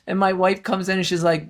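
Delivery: ordinary delivery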